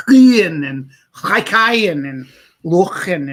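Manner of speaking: IAN, HAIKAYAN, LOCHEN drawn out with throaty "kh" sounds; slow, loud guttural scrape on IAN, HAIKAYAN and LOCHEN; exaggerated Welsh names. Imitating throaty Welsh audiobook names.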